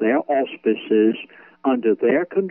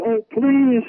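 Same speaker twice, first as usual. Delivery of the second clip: desperate pleading; 12 semitones higher than usual. Pretend. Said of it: "and you'll say".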